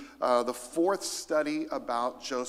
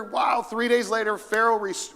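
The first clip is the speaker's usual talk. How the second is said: usual speaking voice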